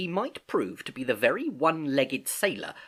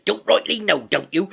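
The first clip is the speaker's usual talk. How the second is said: high, loud mocking retort; Silver scornfully echoing; 7 semitones higher, 8 dB louder than usual. A narrator's reading. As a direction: throw his words back at him. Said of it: "cried Long John".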